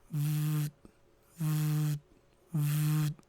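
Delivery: low, softly voiced vibrating buzz; imitated phone buzz. Imitates his phone buzzing.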